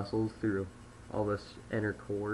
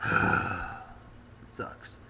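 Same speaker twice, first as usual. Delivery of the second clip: breathy, unvoiced sigh; upset sigh; 3 semitones lower than usual. Shown as a demonstration. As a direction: breathe out the disappointment. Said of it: "so it'd be like".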